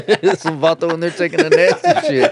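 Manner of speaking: laughing through the words. Real, not acted. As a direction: crack up while saying it. Laughing while telling joke.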